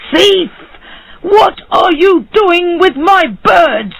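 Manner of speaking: furious high shout at a thief. A narrator's reading. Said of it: "he shouted".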